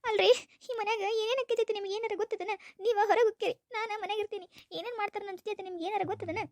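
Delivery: high-pitched, crying grievance; tearful complaint. Acted. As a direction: whine tearfully to win sympathy. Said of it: "ಅಳಾಕತ್ತಿದಿ?".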